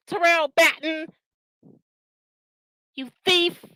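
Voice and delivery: real outrage, pitched high; furious rant. Real, not acted. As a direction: lash out at the liar. Furious tirade at the rival.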